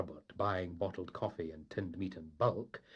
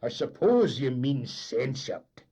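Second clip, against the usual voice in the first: loud, slow superior tone; condescending correction; 5 semitones higher, 9 dB louder, slower than usual. Acted. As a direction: correct him with disdain. Engineer correcting.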